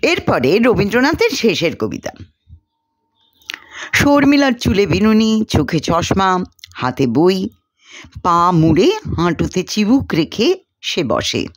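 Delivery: ordinary delivery, nothing marked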